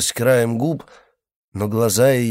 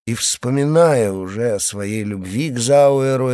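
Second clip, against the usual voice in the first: plain reading voice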